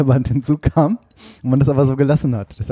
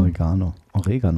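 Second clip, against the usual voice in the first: "Oregano" low and falling, stressed o-RE-ga-no; 6 semitones lower, 3 dB quieter than usual. Shown as a demonstration. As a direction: repeat "Oregano" with weight on RE. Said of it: "liegt die Betonung eigentlich auf dem E".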